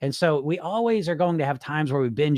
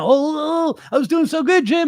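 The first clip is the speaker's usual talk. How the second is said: high, loud whiny wail; mock whining; 11 semitones higher, 4 dB louder than usual. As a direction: wail like a dieter knocked off track. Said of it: "'cause they're probably gonna say more dumb shit".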